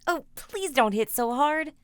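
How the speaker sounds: a mild wince of a plea; pleading boy. Acted. Jack begging his mother while beaten, mild plea. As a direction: beg her to stop hitting, lightly voiced.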